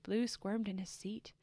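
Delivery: usual speaking voice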